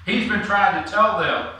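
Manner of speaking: ordinary delivery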